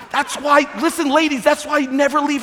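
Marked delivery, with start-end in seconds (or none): none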